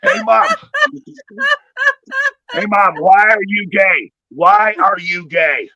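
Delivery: high, loud, stretched-out stoner whine; goofy drawn-out stoned question; stoned teen. Retold or imitated. Stoned son keeps mom talking, loud drawn-out whine. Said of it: "I go like this".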